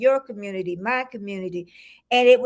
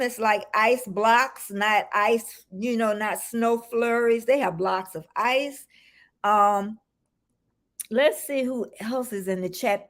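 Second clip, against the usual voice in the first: usual speaking voice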